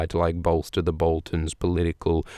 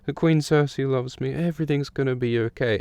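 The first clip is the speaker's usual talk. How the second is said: higher and softer, lost in a daydream; a princess fantasy murmured happily; dreamy Sansa; 6 semitones higher, 4 dB quieter than usual. Retold or imitated. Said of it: "she's like".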